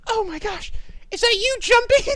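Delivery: put-on high squealing girl; excited girl voice. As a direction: gush like a delighted girl. Voicing Akane, high.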